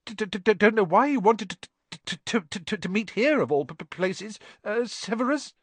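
high, loud nervous stammer, repeating every sound; a timid professor tripping over each word; stuttering Quirrell. Acted. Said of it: "he was stuttering worse than ever".

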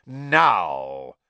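loud, drawn-out menacing order; dangerous. A narrator's reading. Snape ordering Ron to swap roots, dangerously. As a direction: cut off argument with menace. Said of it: "in his most dangerous voice".

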